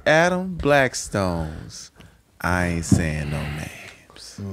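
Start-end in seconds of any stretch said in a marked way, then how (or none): 2.4-4.4 slow dramatic announcer. Acted. Announcing the segment title.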